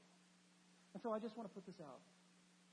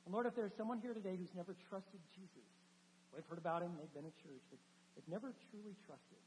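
soft, low prayer voice; hushed pastoral prayer; closing prayer; 4 semitones lower, 7 dB quieter than usual. Acted. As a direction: pray gently over the congregation.

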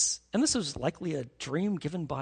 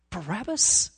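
incredulous. Retold or imitated. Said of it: "I think Pilate was like".

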